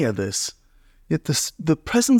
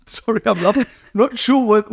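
real laugh breaking into higher-pitched words; 8 semitones higher than usual. Real, not acted. Listener reacting to God saying go say hello, laughing. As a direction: talk through a surprised laugh, voice lifting.

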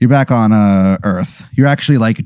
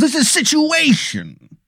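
much higher, slow, softer wiseguy voice; Tony Italiano mobster; 4 dB quieter, slower than usual. Acted. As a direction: stretch it like a cartoon mob boss. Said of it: "he goes".

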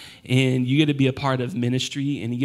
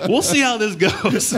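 much higher, laughing nervously; 8 semitones higher than usual. Real, not acted. Emotional speech, laughing nervously.

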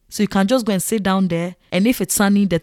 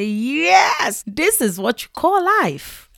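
delighted; 7 semitones higher, much slower than usual. Retold or imitated. Delighted at mom's fufu.

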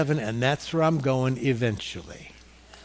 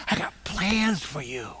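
tender promise; 5 dB quieter than usual. Acted. God speaking tenderly to his child.

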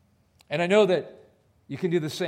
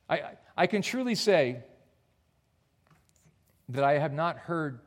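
ordinary delivery

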